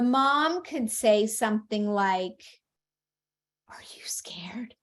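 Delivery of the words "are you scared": put-on soft whisper to a child; very quiet, whispered asking; hushed question. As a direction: whisper it, checking on a little one. Mom gently asks child.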